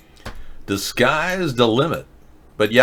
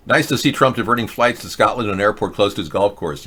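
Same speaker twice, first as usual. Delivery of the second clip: ordinary delivery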